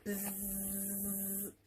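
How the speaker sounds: quiet, drawn-out bee buzz; soft buzz. Acted. Norman practising buzzing, soft bee buzz.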